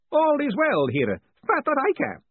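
put-on high, lively elderly wizard; sprightly old wizard. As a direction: chirp away like a cheery old man. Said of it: "said the disembodied voice".

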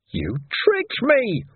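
high, slow wailing complaint "tricked"; stretched high wail on "tricked". Acted. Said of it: "Ferret wailed".

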